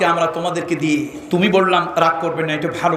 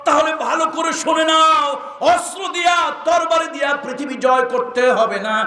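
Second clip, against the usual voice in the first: high, impassioned declamatory cry; emotional cry; 10 semitones higher than usual. Acted. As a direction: call out high, overcome with feeling. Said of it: "আবেগে একটা চিৎকার দিয়ে বলল".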